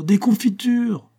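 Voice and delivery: put-on delighted child calling out, drawn out; excited cry. A narrator's reading. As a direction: sing out the word as she repeats it. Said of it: "s'écria Adèle".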